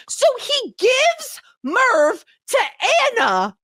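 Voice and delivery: high, slow, drawn-out outrage; outraged disbelief. Acted. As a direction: stretch it out in shock. Outraged plot reveal.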